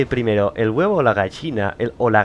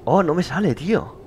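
ordinary delivery

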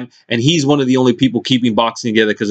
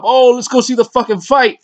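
high, silly mock fan voice; mocking hyped fan; 11 semitones higher than usual. Mocking hyped-up casual fans begging to see the fight, high silly voice. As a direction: ham up an overexcited fight fan. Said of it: "They still get all hyped up".

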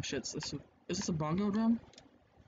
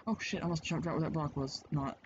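normal talking voice

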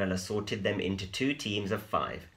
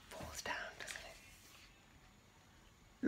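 whisper; 15 dB quieter, much slower than usual. Real, not acted. Teacher whispers the answer to the children, slow and hushed. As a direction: lean in and whisper slowly.

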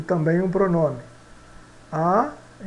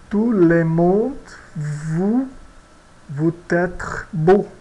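slow French example; much slower than usual. Shown as a demonstration. Teacher reading the example sentence slowly to students. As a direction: Tout le monde vous être beau said very slowly. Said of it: "Vamos ver o nosso exemplo".

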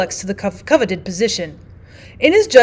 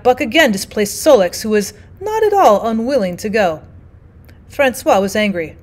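ordinary delivery, nothing marked

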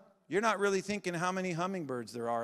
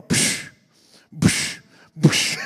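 imitated blowing puff; 9 dB louder, much slower than usual. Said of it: "And he'd go".

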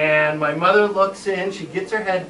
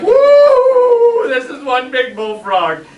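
high, loud thrilled cry; excited whoop; 10 semitones higher, 9 dB louder, much slower than usual. Retold or imitated. Boy spotting a giant bullfrog, thrilled high cry. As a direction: squeal with delight at the find. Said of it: "like, you know, like".

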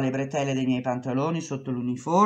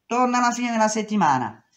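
dismissive boss; 8 semitones higher, 4 dB louder, faster than usual. Retold or imitated.

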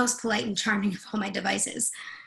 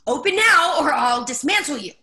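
loud, high near-scream threat; almost screaming; 5 semitones higher, 8 dB louder than usual. Acted. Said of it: "Almost screaming".

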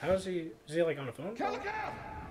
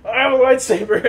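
mock boastful Jedi; 7 semitones higher, 9 dB louder than usual. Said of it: "He was like".

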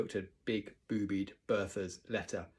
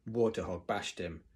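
ordinary delivery, nothing marked

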